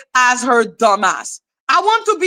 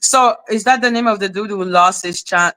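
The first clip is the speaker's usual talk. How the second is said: ordinary talk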